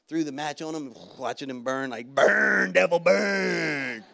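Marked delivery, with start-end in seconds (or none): 2.1-4.0 loud, drawn-out roaring chant; gleeful battle cry. Retold or imitated. Pastor cheering on a burning pile of magazines, gleeful roar. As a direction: roar it at the fire, stretching each burn.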